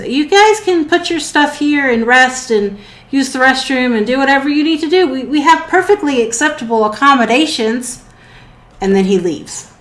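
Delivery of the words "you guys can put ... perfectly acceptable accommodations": high-pitched, quick little robot voice. Acted. Little robot shows guests their sleeping quarters, high helpful voice. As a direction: chatter it helpfully, pitched well up. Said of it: "The little robot ... and says".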